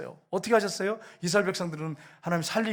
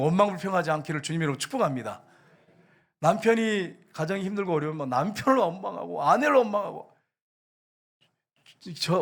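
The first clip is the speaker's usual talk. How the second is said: ordinary delivery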